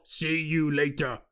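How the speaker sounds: slow cryptid voice; casual Bigfoot. Acted. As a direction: play Bigfoot, drawn out and low. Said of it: "he's all".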